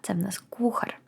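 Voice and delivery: very soft voiceless whisper. Shown as a demonstration. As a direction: hush the answer. Reveals meaning.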